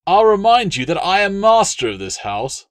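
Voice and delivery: high, loud indignant assertion; outraged master of the house; furious host. Acted. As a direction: put him in his place, shaking. Appius asserting authority.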